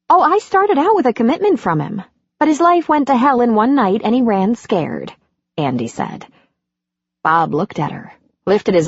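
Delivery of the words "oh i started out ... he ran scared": lightly voiced exasperated mother; Andy's voice. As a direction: tell it with rueful frustration, pitched up. Andy explains about her son.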